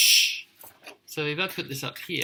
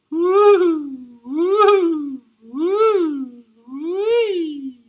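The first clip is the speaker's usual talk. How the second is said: very high, loud woo of the wind; howling wind; 10 dB louder, much slower than usual. Acted. The wind mocks Missus McPhee with a loud howl. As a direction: howl like a teasing gale.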